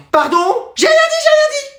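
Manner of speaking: very high, squeaky retraction; hasty backing down. Acted. Child backs down before parent, squeaking.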